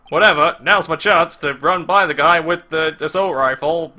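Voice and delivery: usual speaking voice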